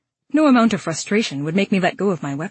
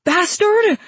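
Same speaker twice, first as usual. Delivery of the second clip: high, loud cry of pain; pained shriek; 14 semitones higher, 5 dB louder than usual. A narrator's reading. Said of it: "I shrieked as pain tore through me".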